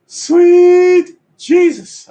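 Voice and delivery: very high, very slow and loud crooned "Sweet Jesus"; falsetto wail. Acted. Hamming up despair to the viewers, in a loud falsetto wail. Dramatic outburst.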